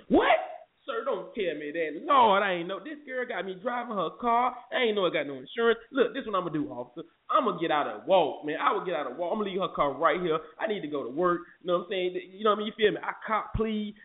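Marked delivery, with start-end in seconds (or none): 0.1-0.4 high, loud shocked yelp of surprise; a sudden squeaked "what" of disbelief. Retold or imitated. Officer reacts in disbelief to the uninsured car, squeaking. 0.8-11.4 higher, mildly frantic pleading with the cop; mock pleading. Driver begs the officer to let him walk, a little frantic.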